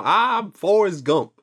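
slow, lightly southern Forrest Gump voice; a hint of a Southern drawl, stretched out slow. To his cohost, his weak Forrest Gump in a slow Southern drawl. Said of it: "it's got that southern drawl".

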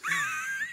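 high, mocking burst of laughter; cruel laugh. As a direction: cackle at the victim. Soldier laughs at Jesus.

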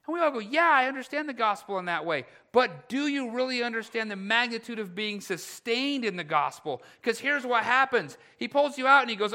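high, loud pulpit challenge; urgent, emphatic sermon delivery; impassioned preaching. Real, not acted. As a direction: press the congregation with full force.

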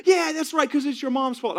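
very high, loud blaming yell; mock outburst. Dad blaming mom to his son, mock yell. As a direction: yell it as a hammed-up accusation. Acting out dad's blame outburst.

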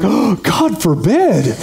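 slow, laughing mock dismay; mock horror. Pastor mocking critics who fear new churches, slow sarcastic laugh. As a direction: drag it out sarcastically. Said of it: "And I was like".

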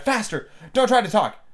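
high, urgent command; Queen's high-pitched order; crying out. A narrator's reading. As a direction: urge them on with a high cry. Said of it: "and she cried".

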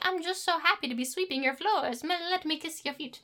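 high, soft, simpering imitation; mock adoring servant woman. Mocking a heroine happy sweeping floors, soft high simper. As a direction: play the devoted doormat, small and high. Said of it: "gets to have a character that isn't like".

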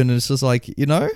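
usual speaking voice

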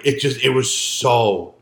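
ordinary talk, nothing marked

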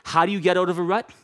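ordinary talk, nothing marked

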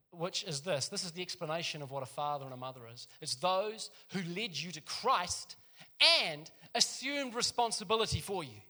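usual speaking voice

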